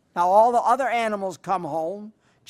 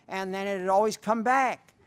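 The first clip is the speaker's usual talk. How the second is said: ordinary talk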